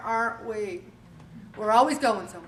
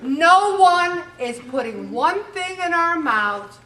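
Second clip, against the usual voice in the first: louder, higher, slowed and laughing; emphatic declaration; 5 semitones higher, 6 dB louder, slower than usual. Real, not acted. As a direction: hammer the point home to the crowd. Punchline to audience, emphatic.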